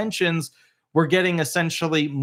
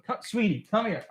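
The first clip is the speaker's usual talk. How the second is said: higher and softer, gentle call; soft coaxing; 5 semitones higher, 5 dB quieter than usual. Real, not acted. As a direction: hush and coax the scared dog. Coaxing frightened dog softly.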